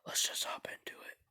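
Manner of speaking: soft breathy whisper. Real, not acted. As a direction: whisper so nobody wakes. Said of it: "I just don't wanna wake up my parents".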